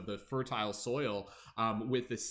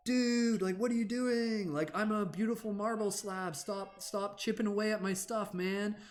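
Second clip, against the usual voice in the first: much higher, squeaky protest; indignant surfer whine; 8 semitones higher than usual. Acted. You protesting the chiseling, higher squeaky dude voice. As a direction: complain like an offended bro. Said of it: "you're just like".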